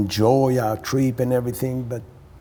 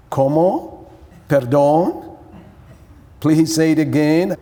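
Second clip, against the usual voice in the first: raised, louder voice of a confused phone operator; puzzled operator; 4 semitones higher, 6 dB louder than usual. Retold or imitated. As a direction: imitate an operator asking for a repeat. Imitating puzzled Mexican phone operator.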